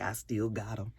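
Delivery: quietly voicing a smug ex; a soft, pleased little aside to herself; smug under the breath. Recapper voices Grace quietly gloating to herself. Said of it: "she kinda smiled to herself like".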